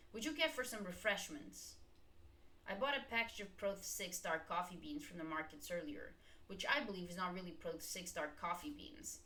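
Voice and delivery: ordinary talk, nothing marked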